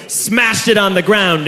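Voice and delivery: ordinary talk, nothing marked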